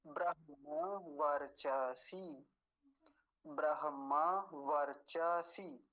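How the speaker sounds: ब्रह्मवर्चसी said whole, slow and even; slow whole word demo. Shown as a demonstration. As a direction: recite ब्रह्मवर्चसी slowly as one word. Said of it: "सारे को मिलाकर एक साथ बोलेंगे".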